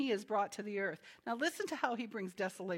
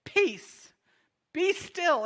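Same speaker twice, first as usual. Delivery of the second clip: loud, raised commanding shout; spoken with authority; 7 semitones higher, 9 dB louder, much slower than usual. Acted. Jesus commanding the storm, loud with authority. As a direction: punch each word, high and forceful. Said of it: "he speaks the authority that is his".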